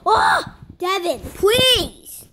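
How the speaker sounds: loud, drawn-out pleading; exasperated plea. Real, not acted. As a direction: beg him to stop, slowly. Startled, pleads with Devin.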